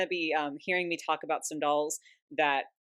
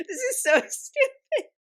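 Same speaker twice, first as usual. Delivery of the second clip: much higher, airy and laughing; breathless giggling; 6 dB louder, slower than usual. Real, not acted. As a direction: gasp it out between giggles. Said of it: "I can't even breathe".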